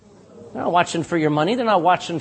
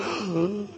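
sharp mock gasp of fear; frightened gasp; 9 dB quieter than usual. A child hears dad is coming, sharp gasp. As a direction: gasp like a kid caught out. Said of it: "you went".

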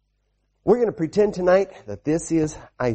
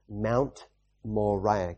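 slow naming; 6 semitones lower, 6 dB quieter, much slower than usual. Shown as a demonstration. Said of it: "Can you say it with me?".

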